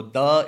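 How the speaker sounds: "the" said as a loud "da"; pidgin pronunciation. Shown as a demonstration. Explaining pidgin to visitors, "the" said as "da". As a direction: punch "the" as pidgin "da". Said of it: "It's the pidgin".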